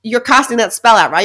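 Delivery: ordinary delivery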